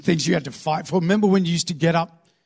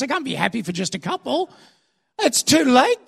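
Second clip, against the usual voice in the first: higher-pitched mock whining; aggrieved complaint; 5 semitones higher than usual. Israelites telling God he came too late, aggrieved. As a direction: wail the grievance up at God, hammed up.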